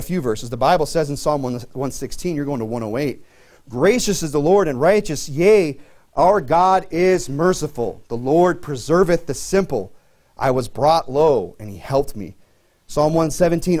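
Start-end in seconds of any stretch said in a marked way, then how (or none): 3.7-12.4 slow, solemn reading of the verse; measured scripture reading from the pulpit. Acted. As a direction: read it out like holy writ, slow. Reads scripture aloud.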